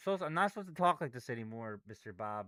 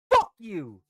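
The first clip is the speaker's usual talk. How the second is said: loud furious curse; angry shout; 2 semitones higher, 10 dB louder than usual. Real, not acted. Trader cursing the chart after a bad move, angry shout. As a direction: bark it at the chart.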